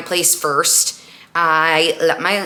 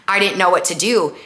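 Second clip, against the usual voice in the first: ordinary talk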